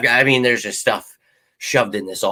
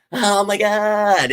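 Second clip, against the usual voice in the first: high, long drawn-out wail of pretend exasperation; slow, high-pitched playful despair; mock overwhelm; 9 semitones higher, much slower than usual. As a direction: stretch it out, as if swamped. Acting swamped by endless comments.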